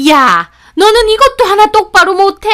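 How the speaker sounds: high, loud angry yell; shrieking scolding. Acted. Mother-in-law at girl who dropped cup, shrieking. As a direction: shriek the reprimand at her. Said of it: "소리를 빽 지르시는 겁니다".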